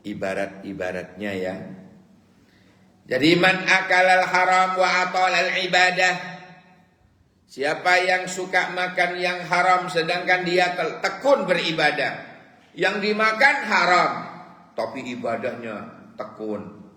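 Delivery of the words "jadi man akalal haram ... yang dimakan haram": high, loud chanted declamation of the Arabic saying; impassioned preaching. Acted. Preacher declaiming the rotten egg proverb to listeners, impassioned and loud. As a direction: cry the proverb out to the congregation.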